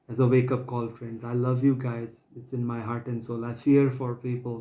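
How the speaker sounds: ordinary talk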